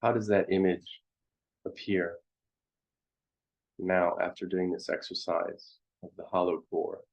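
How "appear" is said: ordinary talk, nothing marked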